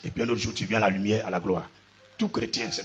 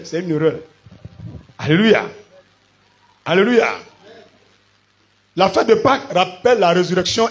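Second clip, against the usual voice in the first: loud, insistent sermon delivery; emphatic preacher, punching the key words; forceful preaching; 7 semitones higher, 8 dB louder than usual. Real, not acted. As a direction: drive each point home to the congregation.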